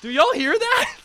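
high, rising-in-volume questioning; real bewildered alarm. Real, not acted. Asking the others about a sudden noise, bewildered alarm. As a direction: call out, baffled by a strange noise.

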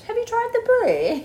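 high, slow mock-English accent. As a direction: put on a hammed-up English accent. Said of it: "like, English".

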